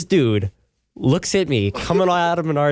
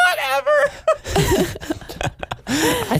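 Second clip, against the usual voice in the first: laughing; slower than usual. Real, not acted. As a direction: burst of high laughter on "Whatever.". Laughing off a teasing jab.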